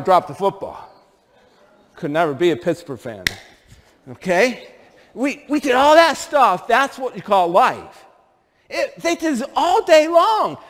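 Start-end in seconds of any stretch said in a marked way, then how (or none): none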